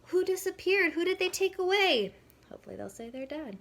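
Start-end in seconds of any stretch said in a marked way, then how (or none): 0.1-2.1 higher, louder, wide-eyed asking; animated question to kids. Shown as a demonstration. Mom asking her kids about the story, higher and louder with excitement. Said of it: "Ask them any question that you can think of".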